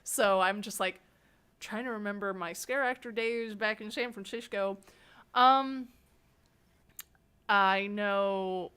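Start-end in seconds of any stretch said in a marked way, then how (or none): none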